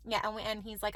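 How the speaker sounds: ordinary talk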